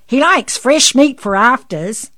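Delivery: loud, high amused call; laughingly. A narrator's reading. Missus Frogmore jokes about the rooster, calling out amused. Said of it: "laughed Missus Frogmore".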